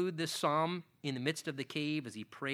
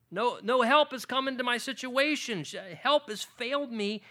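higher, louder pleading preacher voice; preaching plea; 9 semitones higher, 5 dB louder than usual. Acted. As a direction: cry out her despair from the pulpit. Voicing the woman's plea.

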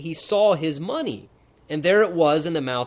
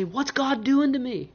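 fearful; 9 semitones higher than usual. Retold or imitated.

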